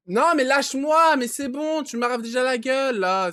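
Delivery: exasperated yell. Real, not acted. Gamer to the creature grabbing him, loud annoyed yelling. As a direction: yell at it to let go.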